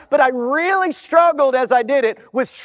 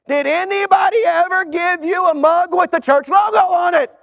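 higher, pressing gatekeeper; gatekeeper interrogating; 5 semitones higher than usual. Acted. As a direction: keep grilling the newcomer. Gatekeeper questions a newcomer at heaven.

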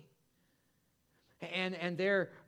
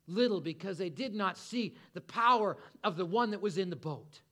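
ordinary talk

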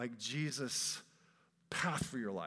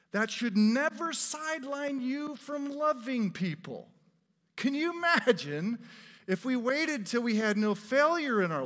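high, loud sermon climax; preaching at full force; 8 semitones higher, 7 dB louder than usual. Acted. Preacher urges the congregation not to sideline themselves, fervent. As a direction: rouse the congregation with fervor.

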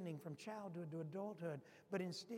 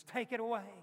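loud, higher mock scream of "Take it away!"; retold scream; 4 semitones higher, 9 dB louder than usual. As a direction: shout it as the prisoner seeing the mirror. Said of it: "and then he screams".

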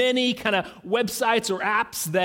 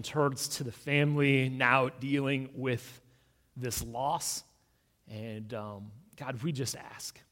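soft, low, unhurried prayer voice; hushed prayer; 10 semitones lower, 9 dB quieter than usual. Acted. Pastor praying over a grieving family, hushed and low. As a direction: pray gently and reverently over the congregation.

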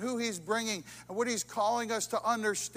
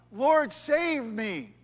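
loud, slowed cry of Lord, save me; cry for help; 4 semitones higher, 9 dB louder, much slower than usual. Acted. Peter sinking in the storm, crying out loudly. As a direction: call out Lord, save me in panic.

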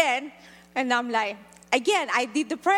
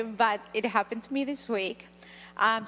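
ordinary talk

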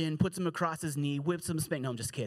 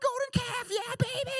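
much higher, whooping party-crowd voice. Retold or imitated. The Israelites party around the golden calf, whooping much higher. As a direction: cheer the idol like you're at a wild party. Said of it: "it's like".

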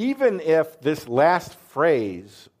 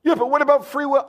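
mocking objector; 5 dB louder, faster than usual. Mimicking an objector.

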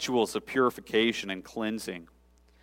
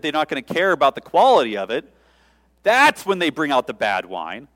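louder, lecturing condescension; condescending; 3 semitones higher, 7 dB louder than usual. Pretend. Head steward scolding the groom, louder and condescending. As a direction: talk down to the groom like a know-it-all. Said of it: "in sort of a condescending turn, tone of voice".

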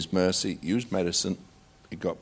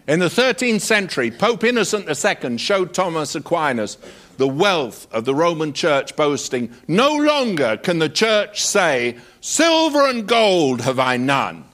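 higher, lively storytelling; relished anecdote; 8 semitones higher, 4 dB louder than usual. Acted. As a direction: tell the Pope and Aquinas tale with gusto. Telling Aquinas anecdote with relish.

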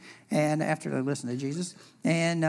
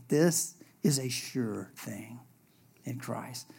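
slow soft whisper; 6 semitones lower, 7 dB quieter, slower than usual. Acted. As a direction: lean in and whisper it. Intimate emphasis.

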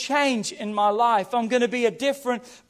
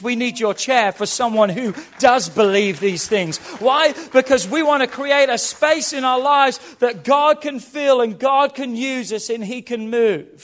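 loud, impassioned sermon climax; raised preacher's voice, building with urgency; full-force preaching; 6 dB louder than usual. Real, not acted. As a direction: drive it home loudly to the whole congregation.

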